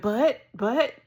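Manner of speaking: a flustered boss tripping over her words; flustered stammer. Retold or imitated. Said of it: "and she's like".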